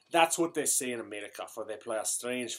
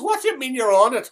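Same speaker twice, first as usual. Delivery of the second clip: indignant retort; 10 semitones higher, 9 dB louder, faster than usual. Acted.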